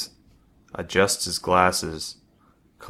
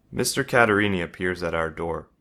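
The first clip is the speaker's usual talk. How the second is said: ordinary delivery, nothing marked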